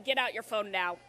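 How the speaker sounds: low, firm scolding mom. Pretend. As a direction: boss them like a mom would. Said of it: "I'm gonna use my mom voice on you".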